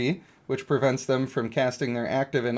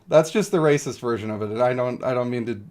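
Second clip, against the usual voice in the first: usual speaking voice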